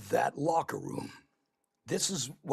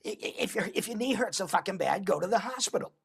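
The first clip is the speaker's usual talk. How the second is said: imitating girlfriend; 6 semitones higher, faster than usual. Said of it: "girlfriend at the time that said".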